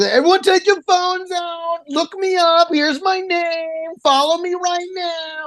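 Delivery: high, slow whiny mimicry; mocking the comedian. Host mocking the comic begging for followers, high slow whiny mimicry. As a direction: parody his pushy plea for followers. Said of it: "I was like".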